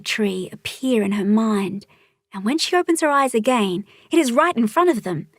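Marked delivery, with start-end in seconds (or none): none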